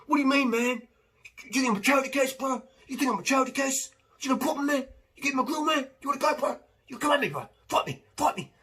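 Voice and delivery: high, loud, aggressive mock bro picking a fight; offended street tough. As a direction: get in their face, taking offence at charity. Street guy reacts to offered help.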